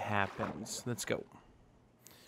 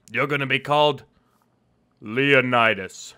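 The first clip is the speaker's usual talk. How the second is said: grand announcement; 8 dB louder than usual. Acted. Naming the horse grandly.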